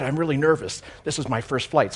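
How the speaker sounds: normal talking voice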